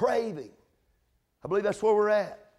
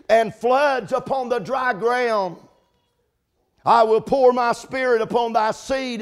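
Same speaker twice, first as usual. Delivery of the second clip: preaching scripture; 3 semitones higher, 5 dB louder than usual. Acted. Reciting scripture with fervor.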